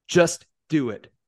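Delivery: slow, mildly growled order. A narrator's reading. Knight overruling his lieutenant, slow growled order. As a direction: draw each word out as a firm command. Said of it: "Geralt growled".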